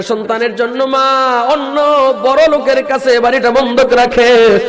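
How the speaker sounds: high, chanted sermon cadence; full-force preaching. Acted. Preacher chanting the tale to the crowd, high and fervent. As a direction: preach it in a rising, sung tone.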